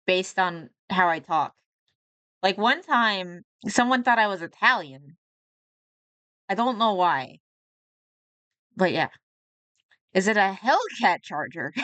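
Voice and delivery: ordinary delivery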